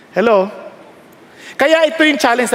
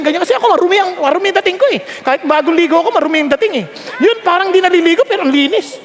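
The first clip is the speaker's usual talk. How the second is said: usual speaking voice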